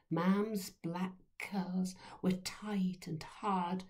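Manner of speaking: ordinary delivery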